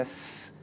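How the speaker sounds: "s" held as a voiceless hiss; extended s. Shown as a demonstration. Teacher contrasting two sounds, "s" held as a hiss. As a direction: draw "s" out as a hissing sound. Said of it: "what's the difference between making".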